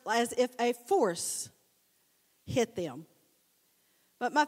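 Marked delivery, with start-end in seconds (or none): 0.1-3.1 slow down and lean on "as if a force"; savoured phrase. Real, not acted. Preacher dwelling on the Greek meaning, slow and emphatic. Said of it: "But I like the words".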